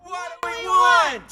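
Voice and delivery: what do we want called loud and slow; protest call. Acted. Protester calling out to the crowd, loud and slow.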